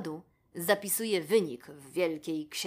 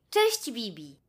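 a touch higher, friendly jackal hello; jackal's greeting; 4 semitones higher, 5 dB louder, slower than usual. A narrator's reading. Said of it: "przywitał się szakal". (szakal wita się lekko wyższym głosem)

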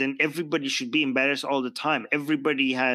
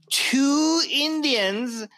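"two" stretched out long and high; stress on TWO; 10 semitones higher, 3 dB louder, much slower than usual. Real, not acted. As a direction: lean hard on "two", drawing it out. Stressing how few were picked.

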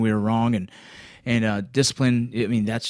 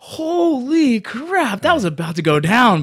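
retold awe; 12 semitones higher, 5 dB louder, slower than usual. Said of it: "I'm sitting there like".

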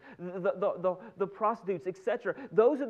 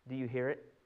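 normal talking voice

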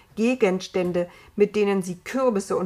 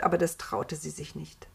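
plain reading voice

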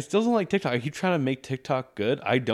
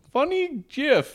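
very high, slow funny gif; mock search; 4 dB louder, much slower than usual. Self mocking old search, high slow voice. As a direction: naive old man typing.

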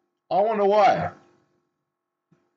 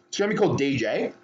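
ordinary talk, nothing marked

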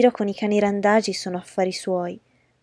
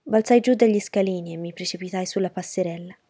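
ordinary delivery, nothing marked

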